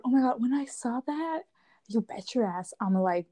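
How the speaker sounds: ordinary talk, nothing marked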